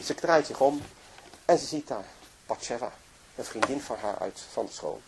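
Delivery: usual speaking voice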